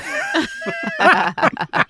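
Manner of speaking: high, squealing laugh; burst of laughter. Real, not acted. Player amused by the shrug, high laughing burst.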